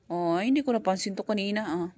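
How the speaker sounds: usual speaking voice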